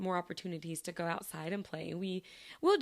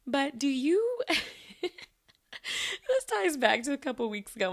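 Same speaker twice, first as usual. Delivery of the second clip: higher and louder, giggling into the words; laughing; 8 semitones higher, 5 dB louder, much slower than usual. Real, not acted. Asking listeners about a funny reading habit, laughing. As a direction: giggle while getting the question out. Giggles introducing a silly question.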